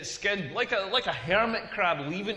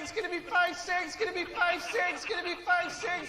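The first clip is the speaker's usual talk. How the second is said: rising higher and higher, then stuck high and repeating; download bar countdown; 10 semitones higher than usual. Retold or imitated. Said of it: "like the internet download bar".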